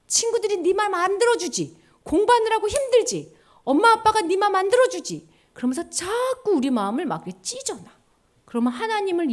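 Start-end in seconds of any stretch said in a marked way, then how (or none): 0.1-5.3 high, needling tempter voice; taunting Satan. Acted. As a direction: tease the child like a sly tempter. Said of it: "사탄이 우리한테 맨날 이렇게 하는 거야". (사탄이 아이들을 놀리듯 높은 목소리로 꼬드김)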